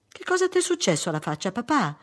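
put-on high-pitched child's question; curious little girl. Daughter asking about father's hurt face, high childlike questioning. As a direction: ask daddy like a small daughter.

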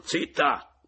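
loud, high, curt command; sharp hushing yell; yelled in her face. A narrator's reading. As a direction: shout her down. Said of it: "le gridai sul muso".

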